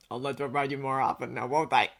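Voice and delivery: lightly voiced low male character; overheard male friend. A narrator's reading. Overheard man speaking.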